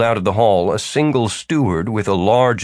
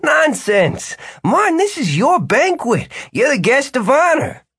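jovial host voice. A narrator's reading. Jimmy insists, voice high far.

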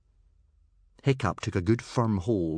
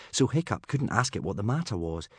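usual speaking voice

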